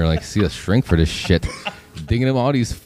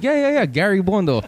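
put-on Korean accent; 10 semitones higher than usual. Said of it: "with a Korean accent".

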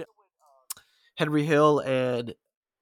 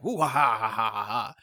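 mustache twirling villain; 3 semitones lower than usual. Retold or imitated. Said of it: "a mustache twirling villain".

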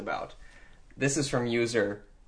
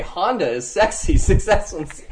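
game show host; 4 semitones higher, 8 dB louder than usual. Acted. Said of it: "I was gonna say".

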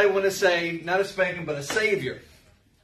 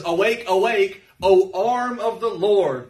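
scripture reading; 4 dB louder, slower than usual. Acted. Reads scripture aloud.

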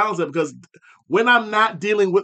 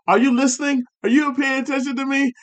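higher, whiny demanding questions; mock needy Southern friend; 8 semitones higher than usual. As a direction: play the offended listener, pitched up. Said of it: "And then I get the whole".

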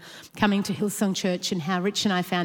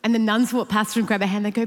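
ordinary talk, nothing marked

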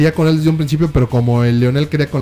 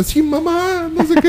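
much higher, whiny plea; mock pleading daughter; slower than usual. Daughter begging her mother in a much higher whine.

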